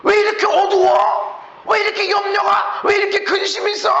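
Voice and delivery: high, loud fretting outcry; mock complaining. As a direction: complain about the gloom. Mimicking a worried complainer.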